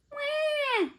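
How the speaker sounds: high-pitched imitated baby wail; baby's yell. A narrator's reading. Hungry baby ghost on the doorstep, high wailing yell. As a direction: squeal out a baby ghost's cry. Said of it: "yelled the baby ghost".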